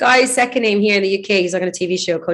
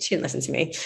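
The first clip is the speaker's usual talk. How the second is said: ordinary talk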